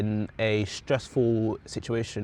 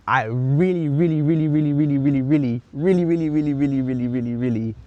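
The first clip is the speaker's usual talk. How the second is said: loud, insistent repeated really, fading out at the end; emphatic really; 3 semitones higher, 7 dB louder than usual. Real, not acted. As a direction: pile on the reallies, louder each time, then ease off. Stressing how badly she wants it.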